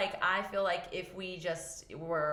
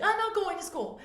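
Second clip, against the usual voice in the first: put-on high, louder child's protest; child refusing; 8 dB louder than usual. Emotional son refusing school, high childish protest. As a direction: whine it like a stubborn kid. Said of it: "he was like".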